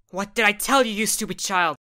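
furious shouting mother; angry mom. Acted. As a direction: yell at the child in rage. Mom drags son out of bed.

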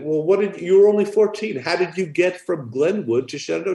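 can't believe she did that; incredulous. Retold or imitated. Quizzing the patient in disbelief.

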